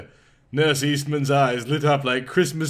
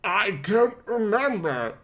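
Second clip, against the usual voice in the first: croaking; 7 semitones higher than usual. A narrator's reading. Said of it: "Bruce croaked".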